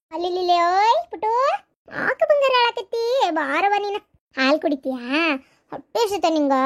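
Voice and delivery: put-on lilting baby talk with big pitch swoops; doting mother's singsong questions; cooing to baby. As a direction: coo and fuss over a crying infant. Soothes her crying baby.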